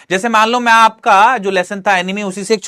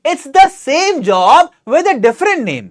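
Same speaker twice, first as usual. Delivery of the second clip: higher, slow, exclaimed read; Noori's voice; 9 semitones higher, 7 dB louder, much slower than usual. Acted. Teacher voicing Noori correcting Samantha. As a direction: exclaim it, then explain slowly. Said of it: "Noori".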